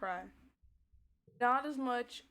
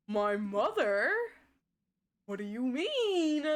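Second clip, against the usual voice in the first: confused surprise; 2 semitones higher, 7 dB louder, slower than usual. Real, not acted. Confused by a chat comment.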